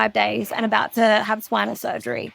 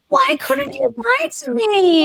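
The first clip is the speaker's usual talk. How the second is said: much higher, slow, strained plea; panicked pleading; 12 semitones higher, slower than usual. Retold or imitated. To the theatre nurse, a high slow panicked plea. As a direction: plead through rising panic.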